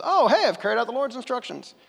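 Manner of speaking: a cheeky put-on innocence; mock innocent Saul. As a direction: toss it off like nothing's wrong. Mocking Saul's claim.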